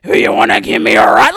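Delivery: put-on leer at a teen like an older predator; predatory older man voice; creepy older man. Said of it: "here comes some forty-year-old".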